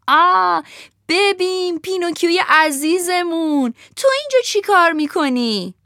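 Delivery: fawning fox greeting the puppet; sly fox voice. Acted. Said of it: "روباهه ... بعد گفت".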